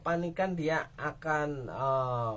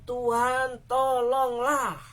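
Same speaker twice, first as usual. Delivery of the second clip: higher, louder, slow cry; pleading cry; 7 semitones higher, 5 dB louder, slower than usual. Acted. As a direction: call out to God in distress. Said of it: "dengan satu teriak".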